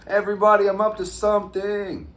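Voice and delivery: loud and high, calling out to the whole crowd; megaphone-style call. Real, not acted. Calling the fam to gather, big loud shout. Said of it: "Need the megaphone".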